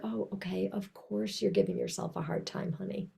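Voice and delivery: soft, tender reassurance to yourself; tender self-talk. Shown as a demonstration. Speaking kindly to her own sadness, soft and tender. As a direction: soothe yourself like a loved child. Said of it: "I hope you notice my tone of voice".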